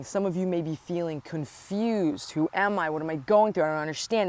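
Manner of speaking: ordinary delivery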